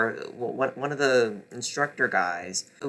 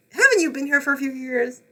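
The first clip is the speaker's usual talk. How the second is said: incredulous mock; 11 semitones higher, 3 dB louder, faster than usual. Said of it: "It's like, bitch".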